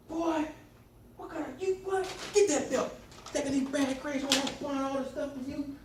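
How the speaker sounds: high, loud mock scolding; scolding mother. Mother acted out over bad grades, loud scolding. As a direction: lay into the kid like a fed-up mom.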